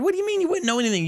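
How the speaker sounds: high, fast, disbelieving cry; incredulous outburst. Real, not acted. Reviewer scoffing at a book's overstatement, high and fast. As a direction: pitch shoots up in outrage at the claim.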